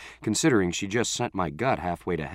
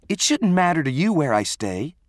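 high, indignant pushback; angry protest; 9 semitones higher, 4 dB louder than usual. Acted. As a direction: tell off a meddling friend, pitch raised. Acted quarrel, Allie answers back.

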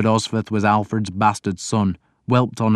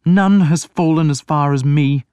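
higher, louder anguished moan; 7 semitones higher, 6 dB louder than usual. A narrator's reading. Alfred to the priest, moaning in self-pity. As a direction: groan out his guilt. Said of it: "Alfred moaned".

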